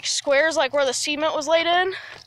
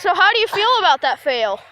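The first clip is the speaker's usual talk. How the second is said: hyped-up joking; 6 semitones higher, 5 dB louder than usual. Real, not acted. Joking after a crash.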